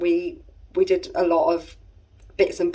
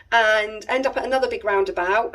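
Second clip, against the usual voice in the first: ordinary delivery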